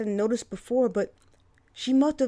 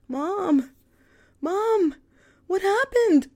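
much higher and louder, alarmed; frantic cry; 12 semitones higher, 7 dB louder, much slower than usual. A narrator's reading. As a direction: cry out in panic at her bedside. Daughter rushing to ICU bedside.

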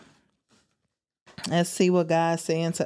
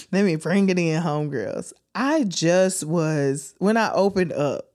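usual speaking voice

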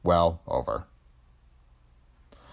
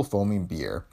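plain reading voice

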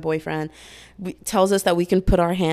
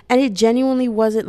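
normal talking voice

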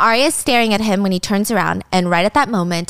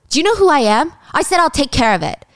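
imitating angry husband; 6 semitones higher than usual. Husband pushes wife out.

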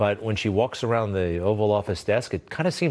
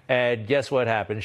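usual speaking voice

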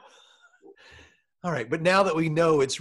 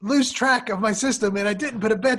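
high, louder exasperated husband; frustrated outburst; 8 semitones higher, 4 dB louder than usual. Acted. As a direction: blame her, rising frustration.

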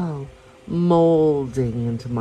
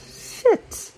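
a sudden high breathy shit, caught off guard; alarmed whispered curse. Acted. Anna caught unprepared by his question, panicked whispered curse. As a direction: gasp the word out in panic. Anna realises she's unprotected.

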